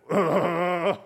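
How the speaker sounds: loud, high pained groan; stabbed-heart groan. Retold or imitated. Man hit by guilt at a child's prayer, hammed-up pained groan. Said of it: "Somebody stuck a knife in my heart".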